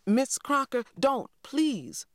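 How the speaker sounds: put-on high, urgent plea from a girl; pleading cry. A narrator's reading. Girl begs teacher to spare her brother, urgent plea. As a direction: beg the teacher to stop. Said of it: "I cried".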